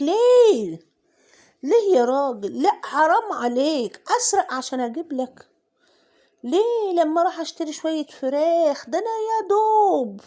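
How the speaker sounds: high, loud, whiny pleading to the butcher; hammed-up tearful protest over the price; mock wailing complaint. To the chicken seller Ahmed about prices, high whiny mock wail. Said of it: "وكنت بعيط، بقول لأحمد بتاع الفراخ".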